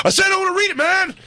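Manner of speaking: mock angry shout. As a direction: snap like a guest finally losing it. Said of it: "It all goes".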